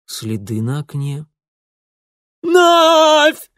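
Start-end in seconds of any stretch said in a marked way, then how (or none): 2.4-3.5 piercing high scream, much louder; shrill screech. Acted. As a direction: screech it in terror. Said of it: "пронзительно заверещал".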